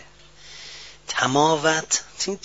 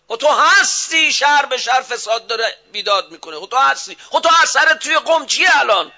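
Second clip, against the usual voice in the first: loud, high rebuking sermon; a preacher's rising loud reproach; fiery preaching; 8 semitones higher, 7 dB louder than usual. Acted. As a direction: thunder at the clergy from the pulpit. Preacher rebukes clergy at full force.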